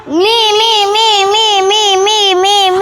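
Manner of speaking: rapid chanted volunteering; pick-me chant; eager clamouring. Acted. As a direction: clamour to be picked, repeating without a break. Children clamour to sing.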